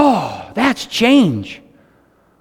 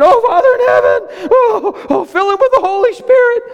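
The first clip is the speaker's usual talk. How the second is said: fervent prayer; 12 semitones higher than usual. Retold or imitated. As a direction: cry out to the Father in rapture. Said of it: "I'm praying".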